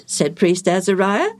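usual speaking voice

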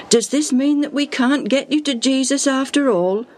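high, worried questioning; dismayed question. A narrator's reading. Friend holding the bed sees crowd, dismayed. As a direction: ask it with sinking hope.

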